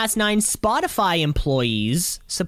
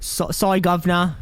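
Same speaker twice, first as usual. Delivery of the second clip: put-on British accent; 2 semitones higher than usual. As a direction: say sorry governor in a put-on London accent. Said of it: "You have to do it British".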